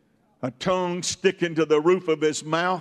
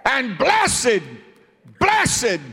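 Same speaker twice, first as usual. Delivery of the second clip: loud, high, slow climb to Blessed; loud preached praise; 5 semitones higher, 4 dB louder, slower than usual. Acted. Preacher as Job proclaiming blessed be the Lord, loud and slow. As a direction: cry it out as a declaration of faith.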